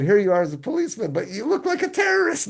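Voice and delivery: high, loud teasing joke; jolly joking tone. Retold or imitated. Guide teasing the bearded policeman, jolly and high. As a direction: rib him like a cheerful friend, pitched high. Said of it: "But it sounded so jolly".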